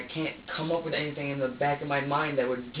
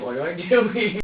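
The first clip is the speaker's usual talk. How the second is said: louder, higher, helpless cry; exasperated outburst; 5 semitones higher, 9 dB louder than usual. Retold or imitated. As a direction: throw up the question in sudden frustration. Acts out helpless frustration at baby.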